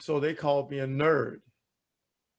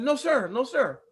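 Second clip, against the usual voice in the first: higher insistent; 8 semitones higher than usual. Retold or imitated. Player refuses to leave game.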